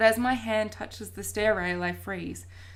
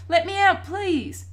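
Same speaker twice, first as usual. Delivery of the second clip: high, loud pleading; desperate yell; 10 semitones higher, 8 dB louder, slower than usual. A narrator's reading. Boy locked in a cupboard, yelling to get out. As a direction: plead loudly to be let out. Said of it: "I yell".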